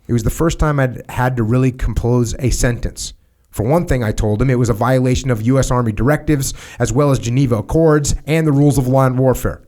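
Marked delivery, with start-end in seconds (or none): none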